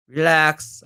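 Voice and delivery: normal talking voice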